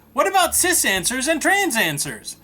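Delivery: ordinary delivery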